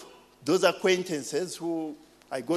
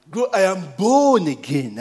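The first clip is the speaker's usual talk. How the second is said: loud, punchy born again; preacher's ringing claim; emphatic declaration; 2 semitones higher, 7 dB louder than usual. Real, not acted. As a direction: proclaim it with force.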